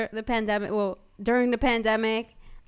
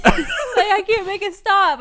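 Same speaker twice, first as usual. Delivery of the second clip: mock crying through laughter; 7 semitones higher, 3 dB louder, slower than usual. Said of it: "mocking partner's crying".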